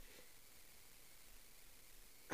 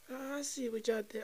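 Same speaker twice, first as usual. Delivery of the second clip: mocking quote; 10 semitones higher, 5 dB quieter than usual. Mocking the couples.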